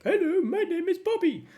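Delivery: very high, loud squeaky puppet voice; be a hand puppet saying hello. Acted. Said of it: "you put your hand in and go like".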